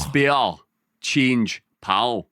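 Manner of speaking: word-by-word Glaswegian demonstration, pausing between words; Glaswegian accent. Shown as a demonstration. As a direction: teach it in Glaswegian, one word at a time. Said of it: "lock you into the Glaswegian accent".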